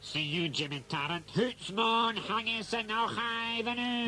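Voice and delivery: lightly done mock Scottish, comic; a bad stage Scot, playing it for laughs; Scottish caller leaving a message, in a mock Scottish accent; hammed-up Scottish accent. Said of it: "if only we could place the accent".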